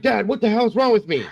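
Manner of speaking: high-pitched mock panic; retold panic. Son runs back from the mirror, retold panic.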